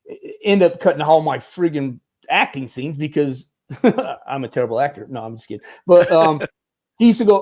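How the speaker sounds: ordinary delivery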